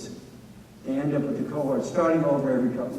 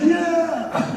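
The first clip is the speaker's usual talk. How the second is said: much higher whoop; drunk cheer; 14 semitones higher than usual. Retold or imitated. Drunk student on the beach cheering about nearly dying. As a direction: cheer it like a wasted kid. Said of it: "He's like".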